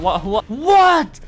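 slow, stammered what in disbelief; stuttering confusion. Real, not acted. Confused gamer stammering in a chaotic fight. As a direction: stumble over the word, baffled.

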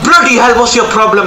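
sharp gasp, then a loud high outburst; startled outburst. Real, not acted. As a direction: caught off guard and furious at the driver. NPC rams his car.

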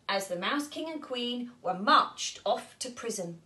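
plain reading voice

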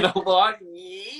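mock-cute fan call of น้องบอส; cute whiny call. Shown as a demonstration. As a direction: coo น้องบอส like a doting fan. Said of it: "ถ้าเป็นน้องบอสนะ งี้".

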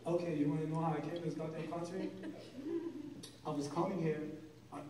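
low, soft Persian immigrant dad; dad's Persian accent. Retold or imitated. Comic telling his dad's arrival story, low Persian accent. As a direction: grumble it like an old Persian father. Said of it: "my dad will be like".

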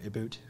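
"About" said as "aboot"; imitating Canadian accent. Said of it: "and he kept going".

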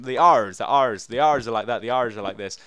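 practising hard American R's in "R's"; American accent R's. Shown as a demonstration. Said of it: "I need to try and get the American".

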